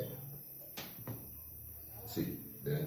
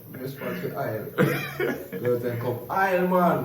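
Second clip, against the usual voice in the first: laughing through the words; 6 semitones higher, 7 dB louder than usual. Real, not acted. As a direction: chuckle while boasting. Laughing while joking about aisle job.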